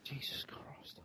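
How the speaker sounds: under the breath; whispered. Real, not acted. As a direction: whisper it in exasperation. Hard quiz question.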